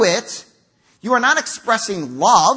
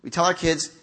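usual speaking voice

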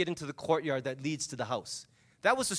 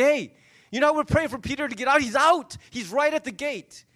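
higher and louder, bursting with news; overjoyed announcement; 5 semitones higher, 4 dB louder than usual. Acted. Servant girl bursting in on the prayer meeting, excited. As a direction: blurt it out breathless with joy.

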